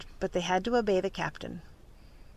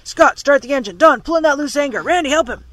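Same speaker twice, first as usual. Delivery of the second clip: loud, high urgent commands; shouted orders; 5 semitones higher, 10 dB louder than usual. A narrator's reading. Captain ordering each crewman in the storm, loud shouted orders. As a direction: fire off orders over the wind.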